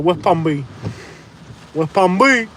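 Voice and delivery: "West Palm B" ending on a bare B; local clipped place name. Shown as a demonstration. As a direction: say "West Palm B" without the end of Beach. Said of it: "There's no B".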